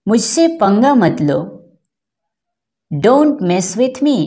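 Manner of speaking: normal talking voice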